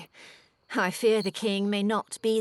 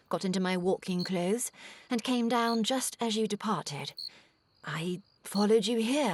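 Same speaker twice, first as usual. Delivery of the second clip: ordinary delivery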